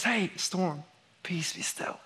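soft, whispered calm command; hushed command. Retold or imitated. Jesus speaking to the storm, in a soft whisper. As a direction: still the storm with a hush.

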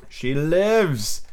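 ordinary delivery, nothing marked

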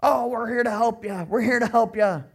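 loud, high phony campaign promise; hammed-up politician calling to the crowd; mock politician. Politician on TV promising voters help, mock earnestness. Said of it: "like every politician I've ever heard".

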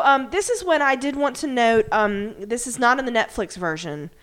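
ordinary delivery

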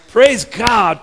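loud, high cry of praise; exultant praise. Real, not acted. Preacher leading the congregation in thanks, exultant shout. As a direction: call it out to rouse the room.

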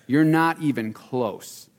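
slowed down, every word weighed; slow insistent echo of Jesus's reply; slow emphatic repeat. Retold or imitated. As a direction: drive it home slowly. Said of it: "And Jesus was like".

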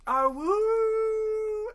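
very high, loud imitated howl; imitating a feeble half-werewolf howl; mock wolf howl. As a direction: throw your head back and howl. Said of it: "And then he goes".

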